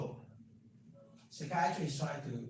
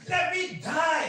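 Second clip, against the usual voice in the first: pleading scream; 12 semitones higher, 13 dB louder, slower than usual. Retold or imitated. Patient screaming at doctor.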